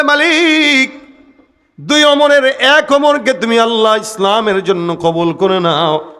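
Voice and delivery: high, drawn-out plea in prayer; pleading call to God. Retold or imitated. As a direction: call out imploringly, easing down at the end. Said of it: "আল্লাহর কাছে হাত পেতে ডাক দেয়া বলে".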